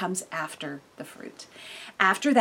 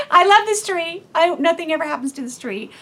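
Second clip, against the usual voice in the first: higher, louder, beaming about the tree; gushing affection; 8 semitones higher, 8 dB louder than usual. Real, not acted. Telling listeners about her orange tree, gushing. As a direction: gush over it like a beloved pet.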